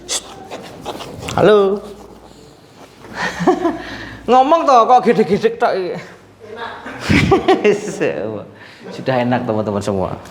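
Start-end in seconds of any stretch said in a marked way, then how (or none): 3.1-4.2 high breathy giggle. Real, not acted. Laugh without words. 4.3-6.2 laughing tease. Real, not acted. Teasing patient in Javanese. 6.5-8.4 giggle. Real, not acted. Laugh without words.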